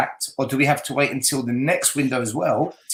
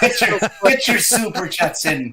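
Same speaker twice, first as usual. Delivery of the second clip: mild mock Irish accent; 6 semitones higher, 3 dB louder than usual. Pundit begs viewers for money in a mild mock Irish accent. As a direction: ham up a leprechaun begging for cash. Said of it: "say it in an Irish accent".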